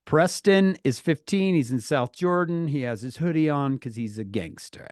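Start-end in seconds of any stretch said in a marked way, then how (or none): none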